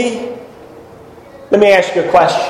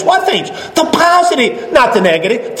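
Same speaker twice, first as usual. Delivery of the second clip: rising preacher emphasis; 5 semitones higher than usual. Acted.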